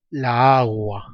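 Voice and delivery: "la agua" with the two a's run into one long vowel; slow, stretched "la agua", a's merging; drawn-out cacophony. Shown as a demonstration. Teacher showing students why la agua is wrong, drawn-out cacophony. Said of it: "está mal".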